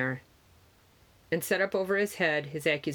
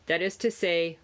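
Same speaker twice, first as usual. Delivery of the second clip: plain reading voice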